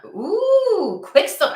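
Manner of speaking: delighted ooh. Real, not acted. Delighted at card drawn.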